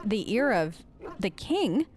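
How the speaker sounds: ordinary delivery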